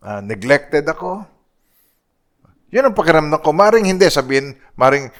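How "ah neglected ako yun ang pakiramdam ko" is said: ordinary delivery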